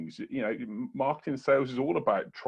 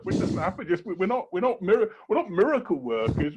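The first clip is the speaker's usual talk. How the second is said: exasperated protest; 6 semitones higher, 3 dB louder than usual. Retold or imitated. Retelling his reply to client expectations.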